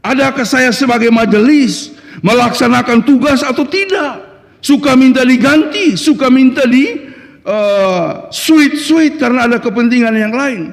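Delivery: high, insistent sermon delivery; impassioned preaching. Real, not acted. Preacher challenging the church council on serving, impassioned preaching.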